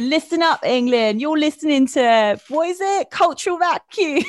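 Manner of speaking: loud, hyped South London presenter; Kiss radio ident. Acted. Guest parodying a radio station ident, hyped presenter voice. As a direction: shout out to the whole country on air. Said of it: "say it like a Kiss ... ident".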